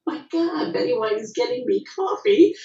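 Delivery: high retold starstruck gasp. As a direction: gush like a starstruck newcomer. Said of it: "I went".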